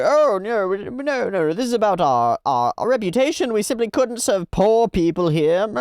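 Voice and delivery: mock a snobbish clerk turning them away; hammed-up posh refusal; snooty waiter. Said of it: "He starts going".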